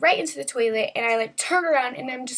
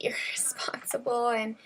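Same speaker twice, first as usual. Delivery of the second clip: whispered aside; 7 dB quieter than usual. Real, not acted. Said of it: "whispered aside".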